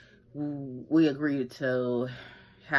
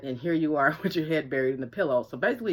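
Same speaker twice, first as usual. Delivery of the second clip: ordinary talk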